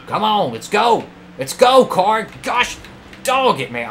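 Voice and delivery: high, loud urging that bursts into exasperation; frustrated yelling. Real, not acted. Player urging his car past traffic, exasperated. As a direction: shout the car on in real frustration.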